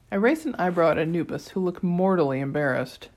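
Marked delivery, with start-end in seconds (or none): none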